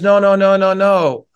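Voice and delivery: loud, pleading no-no-no as the scorpion; emphatic denial. Acted. Scorpion loudly protesting to the doubtful frog, emphatic denial. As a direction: insist hard, protesting too much.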